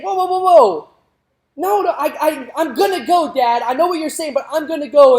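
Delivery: put-on high, loud girlish protest; a teenage daughter brushing off her dad; defiant daughter. Daughter insisting to her father she will go, high and loud. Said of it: "And the daughter is saying".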